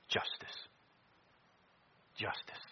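breathy, hushed emphasis; whispered. Acted. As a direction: whisper it with weight.

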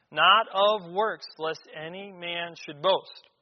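"Not" loud and slow, the rest slowed; stress on not. Shown as a demonstration. Preacher repeating the verse, loud slow stress on "Not". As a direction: hammer "Not" then ease through slowly. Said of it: "it's emphasized here".

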